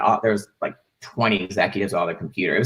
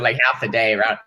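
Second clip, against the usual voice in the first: normal talking voice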